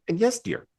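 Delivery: very high, rising cartoon gopher squeak; polite gopher voice. Shown as a demonstration. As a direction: sing it up high like a prim little gopher. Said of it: "talk a little bit like this".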